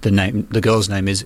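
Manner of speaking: normal talking voice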